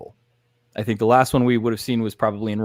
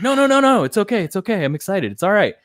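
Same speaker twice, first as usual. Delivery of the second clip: mock reassuring; 8 semitones higher than usual. Din calming the startled child.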